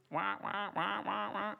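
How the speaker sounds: goose honks. Shown as a demonstration. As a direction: honk like a flock of geese, held back. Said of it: "You ever hear a flock of geese?".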